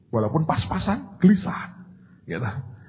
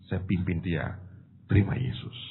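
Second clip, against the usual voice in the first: ordinary delivery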